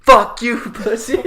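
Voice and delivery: put-on drunken heckle. Drunk bowler yells across the alley, heckling. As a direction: call it out high and slow, as if across the room.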